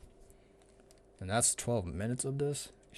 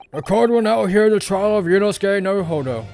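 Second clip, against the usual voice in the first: put-on high, loud, creaky old judge; old man voice; 5 semitones higher, 6 dB louder than usual. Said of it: "give him the old man voice".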